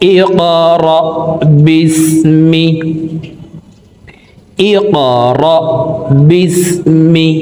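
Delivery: ইকরা with the ক pushed upward in a sharp bounce, বিসমি drawn out; qalqala demo. Shown as a demonstration. Teacher showing the qalqala bounce on ক, emphatic. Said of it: "উপরের দিকে ধাবিত করে ধাক্কা দিয়ে উচ্চারণ করব".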